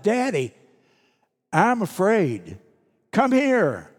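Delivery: put-on high, frightened child's call. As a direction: call out to daddy like a scared little boy. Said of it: "he hollered out".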